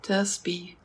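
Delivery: whispered meditation cue; soft spa whisper. Acted. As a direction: breathe the words softly.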